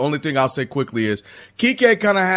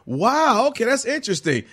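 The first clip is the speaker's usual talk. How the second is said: higher-pitched pretend curiosity; imagined interested reaction; 6 semitones higher than usual. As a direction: play a mildly impressed listener taking it in. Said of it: "instead of being like".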